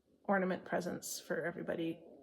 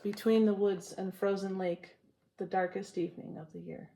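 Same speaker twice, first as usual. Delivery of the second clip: poem reading. Acted. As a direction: savour each line of the poem. Reads Frost poem to viewers.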